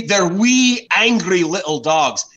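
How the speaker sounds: imitated Scot, high at first, then slowing; Scottish accent. Relishing a friend's line about terriers, in an imitated Scottish accent. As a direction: repeat it in a put-on Scottish accent, stretching the end. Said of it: "forgive my Scottish accent".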